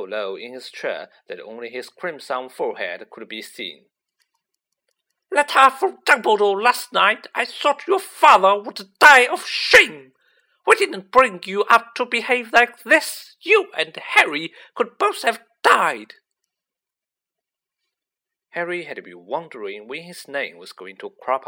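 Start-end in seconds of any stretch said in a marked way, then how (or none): 5.3-16.1 high, very loud motherly tirade; enraged shouting at full force; furious yelling. A narrator's reading. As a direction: scream a scolding at your son. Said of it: "Missus Weasley's yells, a hundred times louder".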